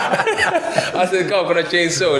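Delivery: high-pitched punchline through laughter; laughing. Real, not acted. Man repeating his plea to the guard, laughing hard. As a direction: repeat the punchline while cracking up.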